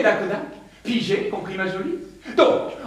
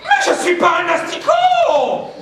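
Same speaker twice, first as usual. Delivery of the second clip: indignant shout; 9 semitones higher, 4 dB louder than usual. Acted. Caterpillar protests indignantly.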